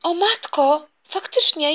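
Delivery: ordinary talk, nothing marked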